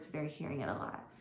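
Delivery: ordinary talk, nothing marked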